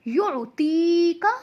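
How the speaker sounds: slow "युतीका" with "ती" held long; lengthened ti. Shown as a demonstration. Teacher models the word, "युतीका" drawn out on "ती". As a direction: stretch "ती", keep "का" short. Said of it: "अब हमने ती को क्यों खींचा है?".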